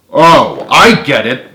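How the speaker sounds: loud, cutting sarcasm; sarcastic. A narrator's reading. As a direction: throw it back with biting sarcasm. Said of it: "with awful, full sarcasm".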